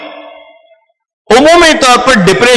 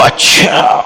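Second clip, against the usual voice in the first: whispered acknowledgement; much slower than usual. Shown as a demonstration. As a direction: murmur "اچھا" under the breath to show you are listening. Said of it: "مولانا کا انداز ہوتا تھا".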